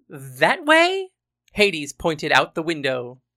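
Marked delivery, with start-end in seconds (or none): 0.1-1.1 high, questioning guess; high-pitched, hopeful question; unsure guess. A narrator's reading. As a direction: shoot the pitch right up as you guess. Hades guesses the train's direction.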